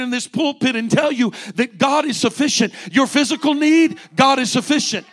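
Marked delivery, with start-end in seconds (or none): none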